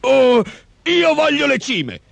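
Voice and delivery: high, slow, clucking creature voice; clucking ogre. A narrator's reading. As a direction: cluck it out greedily, high and drawn out. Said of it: "chiocciò l'omone". (orco chioccio, voce acuta)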